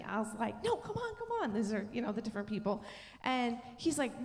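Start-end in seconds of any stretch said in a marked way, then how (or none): 0.6-1.5 high-pitched retold urging; eager mock insistence; playful pleading. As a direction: coax a reluctant partner, voice jumping up. Retells arguing with her husband.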